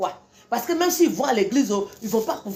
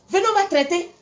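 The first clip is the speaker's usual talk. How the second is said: raised challenge; 8 semitones higher, faster than usual. Acted. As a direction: call them out, pitch jumping up.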